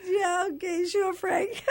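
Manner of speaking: high, laughing sure thing; giggling disbelief. Retold or imitated.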